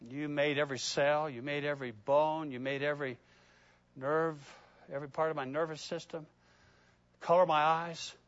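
soft, low intimate prayer; hushed prayer. Acted. Preacher praying softly to the Lord about his body. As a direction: pray it quietly to the Lord.

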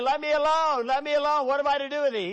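high, loud demon cry on me and I; wrong reading. Shown as a demonstration. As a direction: stress me and I as a single demon. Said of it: "He's not saying".

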